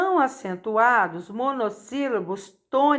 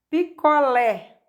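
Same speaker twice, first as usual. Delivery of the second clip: "Picolé" slowly, stressed on the final "lé"; tônica em LÉ; 3 semitones higher, 4 dB louder, slower than usual. Shown as a demonstration. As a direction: stretch out "Picolé" and land on "lé". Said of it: "sendo a mais forte é o lé". ("Picolé" devagar, com força no "lé")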